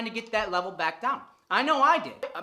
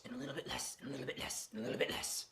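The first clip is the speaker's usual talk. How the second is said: softer and softer into a whisper; fading away; 7 semitones lower, 14 dB quieter, faster than usual. Acted. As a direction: let each repeat die out. Repetition fading to nothing.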